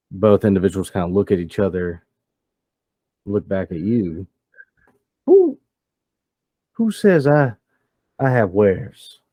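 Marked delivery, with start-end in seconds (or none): 5.2-9.2 taken-aback challenge. Acted. Gang boss to a visitor asking for wares, taken aback. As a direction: stammer a startled challenge.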